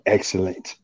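a hint of a gloating cartoon boss; Mister Burns excellent. Rubbing his hands together, a light quiet villain imitation. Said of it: "rubbing my hands together like Mister Burns".

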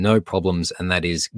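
ordinary talk, nothing marked

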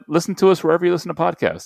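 quick podcast outro plug; ad read. Acted. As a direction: rattle off the plug like an ad. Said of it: "podcast outro plug".

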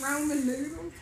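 ROMAN with a long O, through laughter; insistent accented ramen. Shown as a demonstration. Storyteller defending her accent, laughing, ROMAN with a long O. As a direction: laughingly insist on Roman noodles, "roh" up front. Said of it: "It's Roman noodles".

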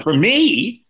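high, loud "for me?"; retold astonishment. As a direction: squeak it out in disbelief. Told the line is for him.

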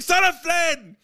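high, loud mock yell; retold random scream. Retelling his birthday shout at the bar, high and loud. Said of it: "I just screamed randomly".

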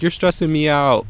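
loud mocking throw-back of the boss's words; mocking echo. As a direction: fire her own words back at her, mocking. He echoes the boss mockingly.

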